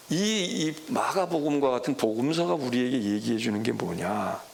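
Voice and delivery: ordinary delivery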